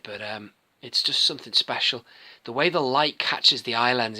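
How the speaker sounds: usual speaking voice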